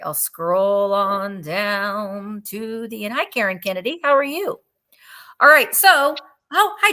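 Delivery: ordinary talk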